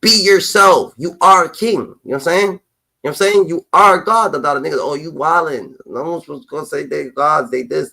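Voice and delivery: normal talking voice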